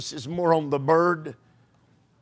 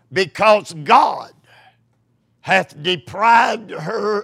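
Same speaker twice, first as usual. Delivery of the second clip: scripture reading; 3 semitones higher, 3 dB louder than usual. Acted. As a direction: declaim the verse. Reads scripture, slow and loud.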